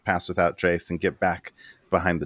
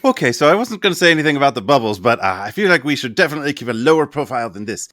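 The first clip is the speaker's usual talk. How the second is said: quick in-character aside; 5 semitones higher, 7 dB louder, faster than usual. Acted. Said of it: "and is like".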